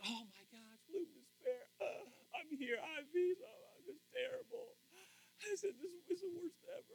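high, whimpering complaint, hammed-up; mock sob of a self-pitying believer; mock whining despair. Churchgoer mocking complainers, whiny fake crying. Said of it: "So we always look at like".

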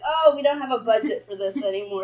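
loud pretend excuse; mock budget officials. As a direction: breezily imitate officials brushing people off. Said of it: "They're gonna be like".

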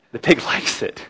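laughing through the punchline. Retold or imitated. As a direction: repeat it while cracking up. Cracks up repeating the punchline.

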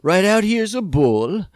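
mock Southern accent. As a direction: play a Southern rancher showing off his livestock. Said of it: "sounds like a Southern thing to say".

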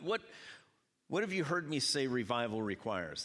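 ordinary delivery

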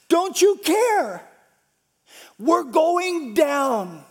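high, loud desperate cry over the storm; panicked shout. Acted. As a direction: shout in panic as the boat sinks. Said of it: "woke him up shouting".

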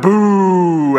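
loud, long mock boo; booing crowd. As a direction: boo like a rowdy audience. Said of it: "at the bad stuff".

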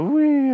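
high-pitched playful wheee; carefree squeal of flight; gleeful whee. Acted. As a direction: let out a high, floaty whee. Acting out flying away, carefree.

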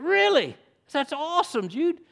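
high-pitched retold amazement; delighted surprise. Retold delighted reaction to grandson.

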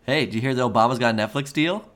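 ordinary delivery

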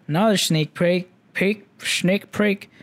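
Chinglish-accented fumble on a repeated phrase; Chinglish accent slip. Real, not acted. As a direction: let a Chinglish accent garble the words, slowing down. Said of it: "Chinglish accent comes out".

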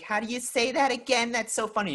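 high, loud mocking coworker voice; mocking New Yorker. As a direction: play the amused New Yorker boss-teasing voice. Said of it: "she was like".

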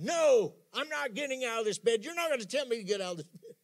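high-pitched acted protest, loud on the first word; stubborn refusal. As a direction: play a patient digging in against getting up. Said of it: "they're going".

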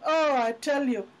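usual speaking voice

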